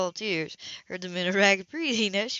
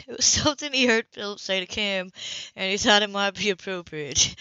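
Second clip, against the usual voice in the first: usual speaking voice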